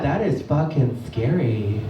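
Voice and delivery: low, slow breathy awe; scared girlfriend. Retold or imitated. Girlfriend gasps slowly about the movie. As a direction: drag it out, spooked.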